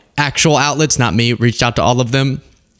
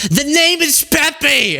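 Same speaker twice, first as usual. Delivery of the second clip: very high, drawn-out imitated yell; slow, high-pitched screech of exasperation; mock scream; much slower than usual. Relative repeating a name to one hard of hearing, mock scream. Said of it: "And just start screaming".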